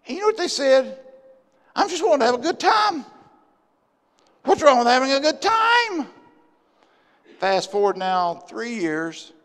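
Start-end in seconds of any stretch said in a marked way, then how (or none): 1.7-6.1 higher, mildly whiny self-justifying retort; pitched-up imitation of a defensive sinner; mock protest. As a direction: imitate someone defending their fun. Acted defiant reply of sinners.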